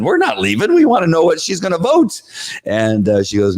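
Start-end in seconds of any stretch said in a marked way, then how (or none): 0.0-2.2 high defiant protest; defiant refusal. Retold or imitated. Parents refusing to leave the room, high defiance. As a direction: refuse it flatly, raised. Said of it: "And we said".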